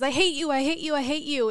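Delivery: put-on a kid shouting his hate at his mother; mildly acted angry yell; angry child. Angry boy yelling at mom.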